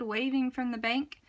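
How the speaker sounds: ordinary delivery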